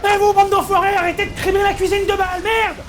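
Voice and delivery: high furious yell; shouting. Acted. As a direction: yell at them across the kitchen. Yelling at imaginary shooters.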